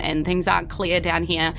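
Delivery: ordinary talk, nothing marked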